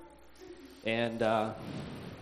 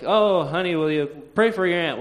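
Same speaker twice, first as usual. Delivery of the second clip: louder imitation of an eager grandma; pleading grandma; 4 semitones higher, 6 dB louder than usual. Grandma asking grandchild to pray for aunt, imitated pleading. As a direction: imitate grandma urging, with a big "oh".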